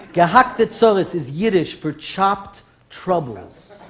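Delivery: normal talking voice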